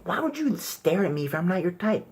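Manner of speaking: high, indignant woman voice; mock woman asking. Comic voicing a woman asking why he stares, high and indignant. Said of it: "you women might be thinking".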